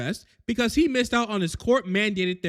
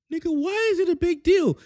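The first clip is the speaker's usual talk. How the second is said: defensive; 10 semitones higher than usual. Acted. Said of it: "Sam answers Bucky".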